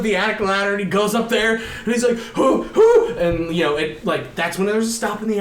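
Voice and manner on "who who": high, loud hooting breaths; mock panicked panting. He hides up in the attic, high mock-panicked puffs. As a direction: puff in exaggerated panic.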